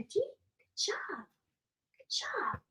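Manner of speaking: soft high whisper to the dog; whispered praise. Real, not acted. Owner quietly praising dog King, whispering high. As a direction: breathe the praise gently.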